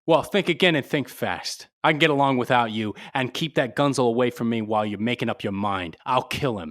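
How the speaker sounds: loud, fast, hard threat; angry threat. Acted. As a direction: bark the ultimatum at him. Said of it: "you have a most violent temper".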